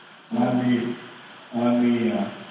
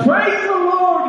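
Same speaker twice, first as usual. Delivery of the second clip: high, slow, loud joyful shout; grandma yells; 5 dB louder, slower than usual. Retold or imitated. Grandma finding groceries on her doorstep, high joyful shout. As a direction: throw it out at the top of your voice. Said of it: "she yelled out".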